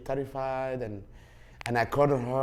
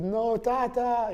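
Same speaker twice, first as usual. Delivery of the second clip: put-on pleading child; 11 semitones higher, 4 dB louder, much slower than usual. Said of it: "I said".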